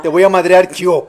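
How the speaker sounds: loud mock menace; mock threat. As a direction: threaten her, hammed up. Acting threat.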